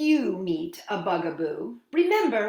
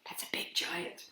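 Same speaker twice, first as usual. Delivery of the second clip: hushed whisper aside; awed whisper to the kids; 4 semitones lower, 12 dB quieter than usual. Pretend. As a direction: whisper it like a secret. Whispered aside about giant.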